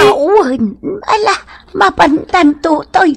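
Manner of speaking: tearful wail, voice breaking; crying. Acted. Old mother crying over her lost things. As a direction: cry out the words through tears.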